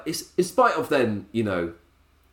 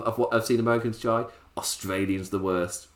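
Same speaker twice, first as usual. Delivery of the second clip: ordinary talk, nothing marked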